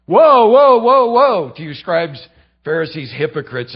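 preacher booming Jesus's rebuke; thundering woe. Retold or imitated. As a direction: hurl the woes at them. Jesus denouncing Pharisees.

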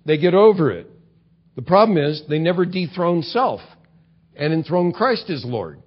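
ordinary talk

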